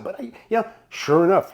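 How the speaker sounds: normal talking voice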